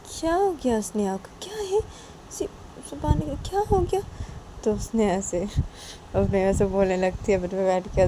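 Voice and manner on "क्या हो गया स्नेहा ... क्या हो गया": high, thin puzzled questions; baffled wondering. Acted. Friend watches Nisha storm out, high-pitched bafflement. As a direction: wonder aloud in a high voice, bewildered.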